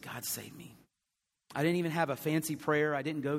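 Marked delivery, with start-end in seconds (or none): 0.0-0.8 quiet plea. Retold or imitated. Retold desperate prayer.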